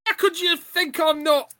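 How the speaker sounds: high, loud affronted protest; mock indignant. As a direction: act wildly offended, pitch shooting up. Mock indignation at accent doubt.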